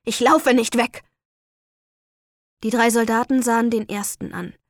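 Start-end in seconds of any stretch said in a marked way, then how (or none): 0.1-1.0 louder, defiant outburst; defiant shout. Acted. Prisoner defiant before execution.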